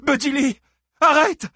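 high, loud alarmed cry; cried out. A narrator's reading. Christine cries out to stop Buddy Lee, alarmed. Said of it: "s'écria Christine".

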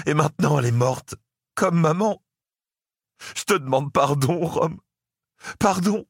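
high, tearful begging; begging his brother through tears not to go; tearful pleading. A narrator's reading. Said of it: "les larmes aux yeux".

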